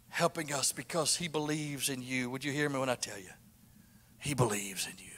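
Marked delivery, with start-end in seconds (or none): 4.2-5.1 breathy hushed whisper, softer. Real, not acted. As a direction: lean in and whisper it. Preacher drops to intimate aside.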